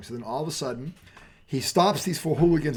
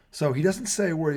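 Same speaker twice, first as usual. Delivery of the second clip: usual speaking voice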